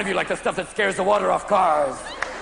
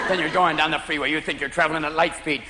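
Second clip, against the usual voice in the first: ordinary talk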